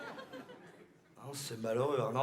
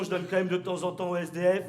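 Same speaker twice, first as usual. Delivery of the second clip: normal talking voice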